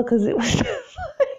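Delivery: words breaking up into high laughter; real laugh squeezing the voice way up high. Real, not acted. Complaining about the app narrator's accent, laughing.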